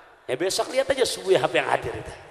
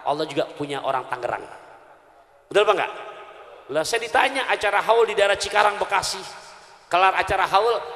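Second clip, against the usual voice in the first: usual speaking voice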